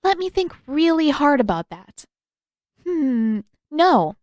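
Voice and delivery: mock thinking, then a high, loud sarcastic no; smirking sarcasm. A narrator's reading. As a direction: tease him with fake consideration. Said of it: "She smirked".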